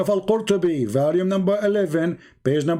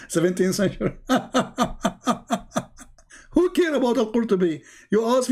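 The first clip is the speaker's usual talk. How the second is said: laughing; 5 semitones higher than usual. Real, not acted. Laughing while mocking date.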